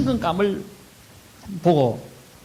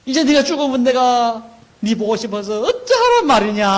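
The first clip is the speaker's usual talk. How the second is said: high, loud mock funeral wail; acted wailing; 10 semitones higher, 5 dB louder than usual. Mourner crying out to the deceased at a funeral, acted loud wailing. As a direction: lament loudly as if keening over the dead. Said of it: "하면서 우는 그런 거지".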